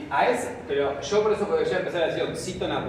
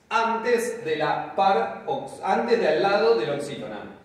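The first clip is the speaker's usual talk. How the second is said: "Antes de la parox-" very slow and higher; slow, emphasised; 4 semitones higher, 4 dB louder than usual. Shown as a demonstration. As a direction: slowly stress "antes" to the class. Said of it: "pro significa antes".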